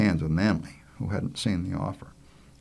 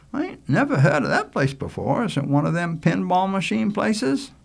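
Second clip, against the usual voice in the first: put-on higher young boy voice; higher-pitched, questioning lad; curious boy; 11 semitones higher, 5 dB louder than usual. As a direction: ask like a country kid who has never heard of it. Richie asks about Joy's.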